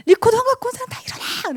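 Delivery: high, loud furious yell at the class; teacher's shout. Retold or imitated. Angry teacher yelling at pupils without recorders, high furious shout. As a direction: bellow it like an angry young teacher. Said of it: "라고 소리를 지르는데".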